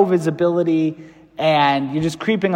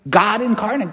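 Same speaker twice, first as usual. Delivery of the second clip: ordinary delivery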